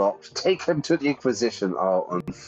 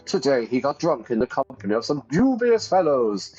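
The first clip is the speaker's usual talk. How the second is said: put-on lofty scornful older brother; Stefan voiced; 2 semitones higher than usual.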